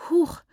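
breathless little huff, pitched high; a tired child puffs out a gasp; high panting gasp. A narrator's reading. Exhausted little girl gasping to her relatives, high. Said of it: "keuchte Rambanai".

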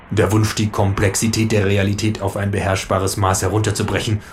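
ordinary delivery, nothing marked

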